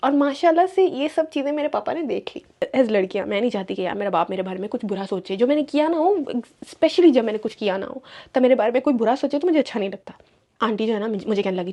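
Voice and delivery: ordinary talk, nothing marked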